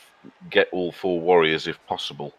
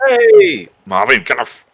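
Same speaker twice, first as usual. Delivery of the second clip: much higher, louder yell at the cat; startled yell to get the cat off; sharp shout; 12 semitones higher, 13 dB louder, slower than usual. Real, not acted. Cat jumping on streamer.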